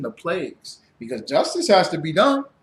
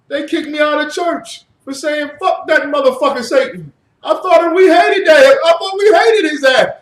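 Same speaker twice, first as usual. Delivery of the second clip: higher, louder indignant rant; meme guy outraged; 9 semitones higher, 7 dB louder than usual. Retold or imitated. Retelling the meme, man protests his expulsion indignantly. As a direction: play the baffled man kicked out of church. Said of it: "a meme of a guy going like this".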